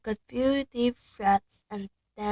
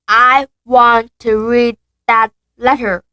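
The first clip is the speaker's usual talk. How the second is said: loud demand; 19 dB louder, slower than usual. Acted. Dudley loudly demanding the letter from his father. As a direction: demand it like a spoiled child. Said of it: "he said loudly".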